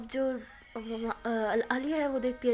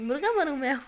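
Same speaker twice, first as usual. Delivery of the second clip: louder, disbelieving offer; exasperated; 3 semitones higher, 6 dB louder than usual. Acted. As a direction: throw up your hands in mock despair. (جھنجھلا کر، اونچی آواز میں)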